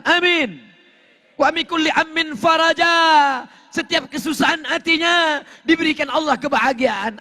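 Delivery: high-pitched, driving sermon delivery; full-force preaching. Real, not acted. Preacher urging congregation to seek forgiveness, full-force preaching. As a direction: hammer out each repeated phrase high and insistently.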